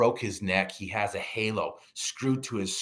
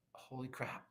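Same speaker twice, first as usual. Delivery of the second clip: whispered awe; hushed realization; 3 semitones higher, 16 dB quieter than usual. Retold or imitated. Patient looking around at worse injuries, stunned whisper. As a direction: barely voice it, stunned.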